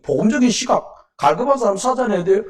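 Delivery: ordinary delivery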